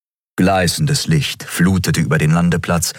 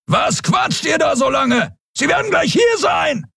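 much higher, roaring shout from afar; bellowing. A narrator's reading. Kero roars at Endriel and Kai to stop talking, a high, furious bellow. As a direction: bellow at them to hurry up. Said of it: "Wieder hörten sie Kero brüllen".

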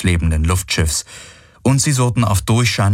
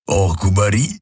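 grumbling. A narrator's reading. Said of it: "brummte der Vierarm".

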